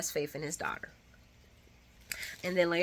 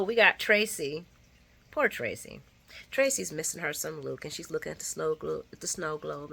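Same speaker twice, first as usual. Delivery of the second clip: usual speaking voice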